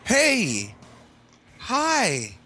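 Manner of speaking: falsetto hey and hi; goofy high greeting. Acted. Called on by the host, answering in a silly falsetto hello.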